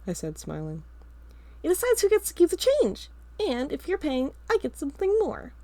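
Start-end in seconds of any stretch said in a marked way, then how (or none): 1.6-5.5 put-on high, loud little-boy whine; pleading kid brother. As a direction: beg like a cheeky younger brother. Carl bargaining cheekily.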